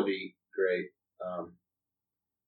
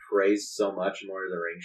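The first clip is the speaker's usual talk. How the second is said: normal talking voice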